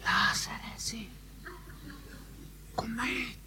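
soft unvoiced whisper of the command. Shown as a demonstration. Preacher mocking a timid call to Lazarus, whisper. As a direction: hush it as if afraid to be heard. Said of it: "Oh, and he didn't whisper it".

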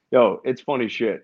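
ordinary talk

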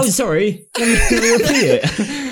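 high, slow, laughing mock apology. Friend mocks the customer's repeat, high and laughing. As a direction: play the rude woman's fake politeness through laughter. Mocking the rude woman, laughing.

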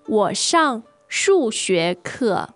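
ordinary talk

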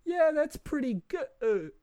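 high, slow, laughing; giggly half-asleep child; dreamy little kid. Retold or imitated. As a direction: drift off happily under the gas.

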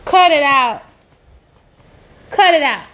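loud, firm order to stop; firm demand. Real, not acted. Scolding the Dominican Republic's critics, loud and firm. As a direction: bark it twice like a scolding.

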